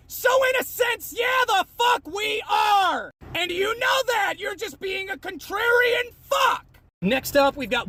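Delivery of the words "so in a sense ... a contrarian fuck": high, exasperated yelling; angry shout. Real, not acted. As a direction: drive the rebuttal home in a raised, angry voice. Angry rebuttal to commenter.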